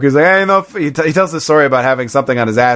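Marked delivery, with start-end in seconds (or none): 0.2-0.6 higher-pitched New York driver; New York accent. Retold or imitated. Quoting the limo driver, in a New York accent. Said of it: "like, from New York".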